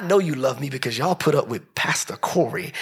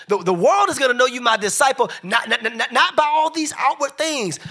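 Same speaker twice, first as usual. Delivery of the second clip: preaching refrain rising higher each time; building preaching; 5 semitones higher than usual. Acted. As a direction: build the repeated line toward a peak. Preaching crescendo, repeated refrain.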